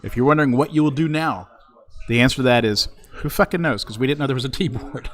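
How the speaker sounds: loud mock press announcement; booming formal announcement, deadpan; announcer voice.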